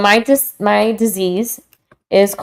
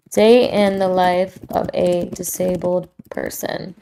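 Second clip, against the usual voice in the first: slow, softer title read; title announcement; 6 dB quieter than usual. Shown as a demonstration. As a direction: announce the title slowly and softly, savoring each word. Repeats it as a podcast title.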